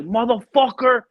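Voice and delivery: high, loud retold curse; gunny going off. Gunny calls his assistant at five A M, going off. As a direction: burst out like an irate gunny on the phone. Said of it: "this guy always went off the chain".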